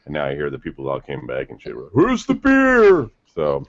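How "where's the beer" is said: drawn-out, much higher and louder cry of disbelief; mock outraged yell. Friend retelling the empty cooler, mock outraged slow yell. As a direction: wail it like the party's been robbed. They find the beer gone.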